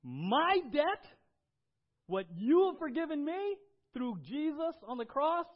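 high, loud preaching burst; full-force preaching. Acted. Pastor to congregation, about Jesus' forgiveness, loud preaching. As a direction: cry it out from the pulpit.

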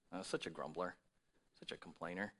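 whisper-soft judgment of others; mock judgmental aside. Mocking judging others.

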